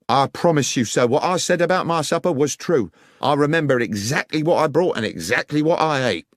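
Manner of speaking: a lightly voiced, pleading railway guard; guard Lyons protesting. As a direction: insist you are telling the truth. Guard protests his honesty.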